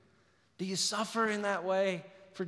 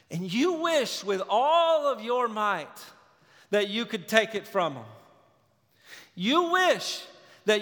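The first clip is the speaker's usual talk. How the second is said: higher, louder, pleading sermon climax; impassioned preaching; 4 semitones higher, 3 dB louder than usual. Acted. Preacher to church on suffering friends, impassioned pleading. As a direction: plead with the congregation from the gut. Preacher rising to emotional peak.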